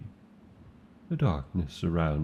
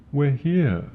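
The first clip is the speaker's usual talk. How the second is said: high, loud call of arrival; high and loud, thrilled to arrive; excited announcement; 8 semitones higher, 7 dB louder than usual. Acted. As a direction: proudly announce we've landed. Announcing arrival after long flight.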